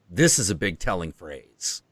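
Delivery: usual speaking voice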